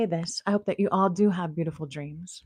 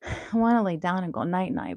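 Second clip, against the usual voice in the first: normal talking voice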